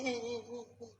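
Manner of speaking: soft high mock sobs; imitated crying.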